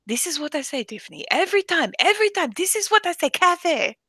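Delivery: high, exasperated imitation with Arabic accent; strong Arabic accent. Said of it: "somebody with a strong accent".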